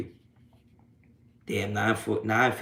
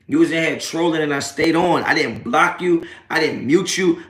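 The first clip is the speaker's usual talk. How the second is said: higher and louder, heated trash talk; angry rant at trolls; 4 semitones higher, 4 dB louder than usual. Real, not acted. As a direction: roast the trolls, voice raised and jabbing.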